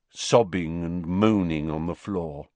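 ordinary delivery

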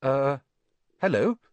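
nervous, halting greeting. A narrator's reading. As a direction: feel your way into an uneasy greeting. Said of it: "said Harry nervously".

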